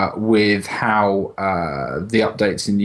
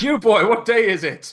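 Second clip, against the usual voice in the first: put-on much higher, louder old-timey shout; Scrooge-style call; 11 semitones higher, 4 dB louder than usual. Calling out the window to the lawn kids, Dickensian shout. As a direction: hail a boy in the street like Scrooge. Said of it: "I was like".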